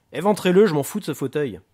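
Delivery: exasperated gangster outburst; angry order. Acted. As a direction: yell at him to just do it. Booker orders chair cut open.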